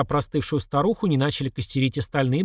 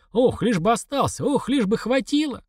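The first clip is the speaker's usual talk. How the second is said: higher, mildly fretful pleading; anxious wishing; 8 semitones higher than usual. A narrator's reading. Old woman hoping the onions last, anxious. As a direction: fret aloud in a raised pitch.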